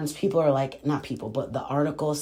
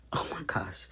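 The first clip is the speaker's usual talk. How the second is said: whispered, much softer, aghast; shocked whisper; 4 semitones lower, 10 dB quieter than usual. Real, not acted. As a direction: breathe it out in disbelief. Her own shocked reaction.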